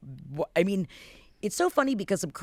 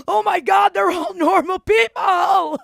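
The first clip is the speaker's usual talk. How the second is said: very high, loud, drawn-out exclamation; acted amazement; 9 dB louder than usual. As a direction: shout it in hammed-up shock. Acting out own amazed reaction.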